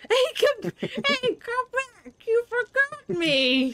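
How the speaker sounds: put-on high, quavering old lady; grandma calling out. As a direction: call after them like a granny. Old lady voice calling after Link.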